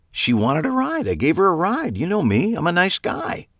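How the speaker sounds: high, sing-song innocence; mock innocent. A narrator's reading. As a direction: play it breezy and innocent. Eli mocking himself.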